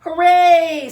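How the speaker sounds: high, loud hooray; cheer. Acted. Friends cheer Franklin's reading club plan, loud. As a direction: cheer with delight.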